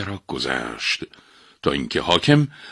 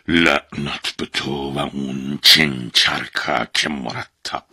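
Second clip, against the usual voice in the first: growling demon; 5 semitones lower, slower than usual. A narrator's reading. Woken demon growling at the object and the thief, slow. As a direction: grumble the curse from half sleep. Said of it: "غرش کنان گفت".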